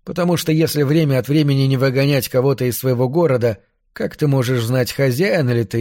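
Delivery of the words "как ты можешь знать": ordinary delivery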